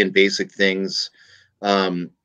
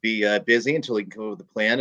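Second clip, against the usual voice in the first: ordinary delivery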